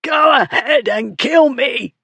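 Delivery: high, slow, strangled defiance; choked shout in a high voice. A narrator's reading. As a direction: force it out as if being throttled. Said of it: "the fool choked out".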